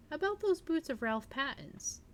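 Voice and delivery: lightly voiced nosy sister; would-be unconcern. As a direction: toss it off as if it hardly matters. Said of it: "with would-be unconcern".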